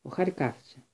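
plain reading voice

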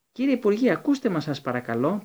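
high urgent plea; pleading. A narrator's reading. Hermione begging the Minister, high and urgent. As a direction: beg him to listen. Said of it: "παρενέβη η Ερμιόνη".

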